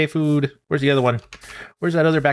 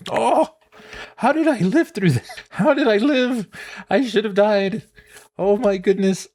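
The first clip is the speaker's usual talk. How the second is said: much higher, astonished; stunned he survived; amazed disbelief; 10 semitones higher than usual. Real, not acted. Survived Enderman fight, amazed.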